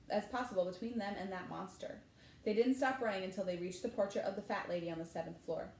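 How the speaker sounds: ordinary delivery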